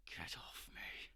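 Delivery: low, whispered growl from underneath; hushed growling struggle of someone pinned; grunting growl. Acted. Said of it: "grunting, growling underneath you".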